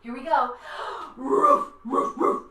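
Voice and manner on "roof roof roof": loud barking dog; playful loud woofs; big dog bark. Shown as a demonstration. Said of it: "loud dogs with a big bark".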